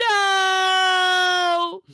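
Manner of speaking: long, loud, high "no"; drawn-out mock-anguished shout; hammed-up cry of protest. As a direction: wail the word out theatrically. Reads out viewer's protest.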